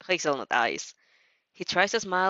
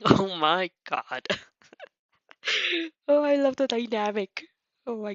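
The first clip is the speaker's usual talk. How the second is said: laughing; 2 semitones higher than usual. Real, not acted. Reader laughs at the scene.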